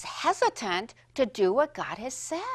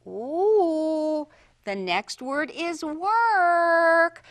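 slow suspense; 3 semitones higher, 6 dB louder, much slower than usual. Shown as a demonstration. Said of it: "teacher builds suspense for word".

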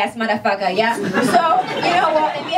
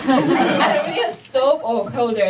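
slow mock awe; much slower than usual. Real, not acted. Mock awe at soap holder.

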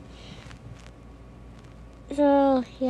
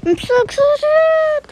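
much higher, slow, squealing delight; excited squeal; 11 semitones higher, 5 dB louder, slower than usual. Pretend. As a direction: squeal it out like a thrilled kid. Pretend shopper at the tailor.

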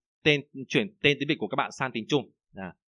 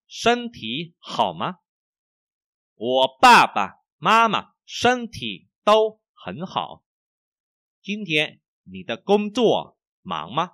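slow, projected Chinese sentences with wide tone swings; model reading; 3 semitones higher, 5 dB louder, much slower than usual. Shown as a demonstration. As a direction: read it for students to copy, syllable by syllable. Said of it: "Bây giờ chúng ta sẽ đọc lại nhá". (đọc mẫu tiếng Trung chậm, rõ thanh điệu)

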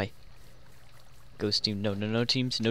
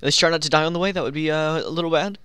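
ordinary talk

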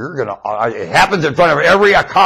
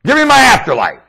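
loud exclamation; 12 semitones higher, 6 dB louder than usual. Real, not acted. Snail voice begins.

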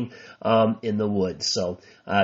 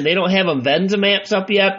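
ordinary talk

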